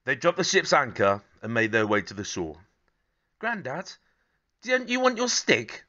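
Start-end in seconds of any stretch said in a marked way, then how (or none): 3.4-5.8 Sid's voice. Acted. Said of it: "Sid asked".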